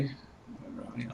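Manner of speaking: quick unvoiced aside off mic; whispered aside. Real, not acted. Player whispers to the table mid-call.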